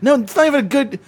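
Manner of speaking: high-pitched, loud exasperation; exasperated scolding. Real, not acted. Scolding a partner for encouraging bullshit, high and exasperated. Scolds the co-host's silly voice bit.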